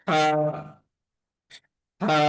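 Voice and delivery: হা from a tightened throat; constricted, throaty হা; squeezed throat. Shown as a demonstration. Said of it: "একদম গলা চেপে আদায় হবে".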